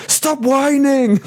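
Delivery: high, drawn-out mock Austrian yell; Arnold Schwarzenegger. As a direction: imitate an exasperated action star, stretching the words. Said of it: "one of the Arnold ones".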